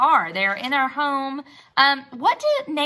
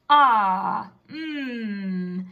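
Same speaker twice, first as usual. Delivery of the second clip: Grandpa pondering. Acted.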